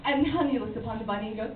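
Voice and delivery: ordinary talk, nothing marked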